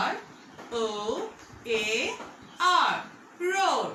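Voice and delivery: letters R O A R spaced with pauses, then "roar"; teacher spelling "roar" for the class; spelling aloud. Shown as a demonstration. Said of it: "it's time to spell the word".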